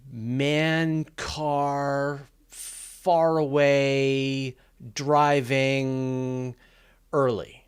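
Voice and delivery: slow, halting word-by-word list, each word set apart; disjointed words. Shown as a demonstration. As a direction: drop single words like a puzzled reader. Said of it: "comes across as a bunch of disjointed words strung together".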